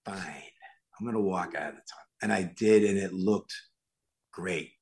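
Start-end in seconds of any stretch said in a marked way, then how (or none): 0.1-2.1 low, soft, giving in; resigned compliance. Retold or imitated. Actor yields to director's tunnel walk, quiet resignation. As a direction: drop the voice and grudgingly agree.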